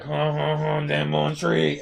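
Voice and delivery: mock Cajun accent, slow; a light imitation of a Cajun accent. Lightly imitating the Cajun South Louisiana accent. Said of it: "where it's like".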